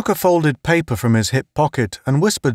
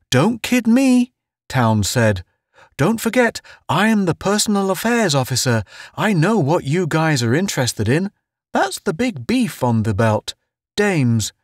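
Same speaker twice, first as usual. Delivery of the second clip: high-pitched know-it-all character voice; cocky, knowing Town; 7 semitones higher than usual. Acted. Town claiming he knows the outposters, high knowing voice.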